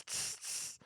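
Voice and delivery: whispered tss of a snare drum; unvoiced drum hits; mouth snare hiss. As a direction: tap out the snare with your lips.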